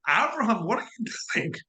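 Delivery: mock outrage at being put on hold; indignant surprise. God put on hold by Abraham, mildly indignant.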